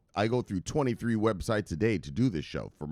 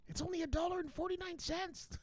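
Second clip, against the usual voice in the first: very high, soft falsetto; mock squeak of a punchline; squeaky joke voice; 11 dB quieter than usual. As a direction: play it as a tiny silly voice.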